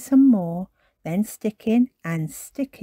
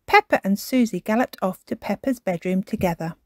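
plain reading voice

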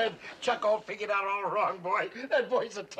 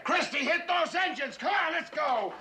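shouted order; 8 semitones higher than usual. Acted. Urgent order to launch.

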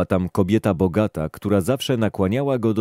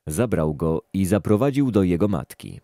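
ordinary delivery

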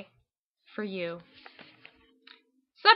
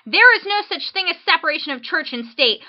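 loud and higher, like typed in capitals; all caps shout; 7 semitones higher, 6 dB louder, faster than usual. Shown as a demonstration. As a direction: shout the whole line as one angry capital block. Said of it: "All caps".

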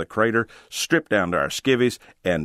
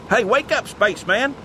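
yelled. Acted. Marty rousing his daydreaming friend in the cab, a high teasing yell. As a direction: shout him awake, much higher. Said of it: "yelled Marty".

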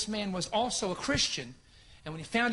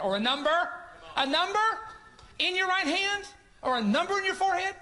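pitched way up and louder, hammering the point; rising preacher's cry; 10 semitones higher, 4 dB louder than usual. Retold or imitated. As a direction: build to a ringing challenge.

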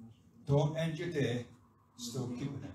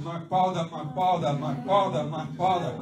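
loud, urgent repeated cry; fervent preacher showing how to shout it; 6 dB louder than usual. Shown as a demonstration. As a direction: shout it over and over in quick succession. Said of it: "Just start shouting".

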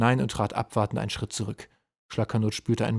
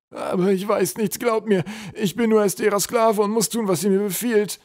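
high, loud troll protest; babbling excuses; 11 semitones higher, 6 dB louder than usual. A narrator's reading. Troll swears he is only a slave, babbling anxiously. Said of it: "plapperte Schlackernot drauf los".

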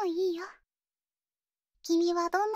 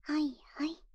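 whisper. Acted. あやすように囁く.

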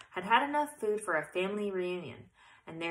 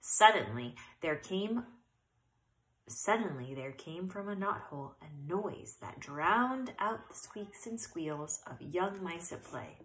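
usual speaking voice

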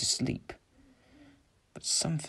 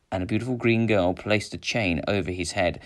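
ordinary delivery

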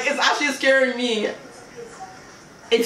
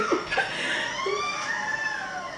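high laughing wail; high crying laughter; shrieking laugh; 10 dB quieter than usual. Real, not acted. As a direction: laugh till it cracks. Imagining victims.

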